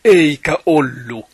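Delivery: loud, high shout in Finnish; fan's protest shout. Shown as a demonstration. Host gives the offside shout in Finnish, loud protest yell. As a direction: yell it out like a supporter in the stands. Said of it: "måste du ropa".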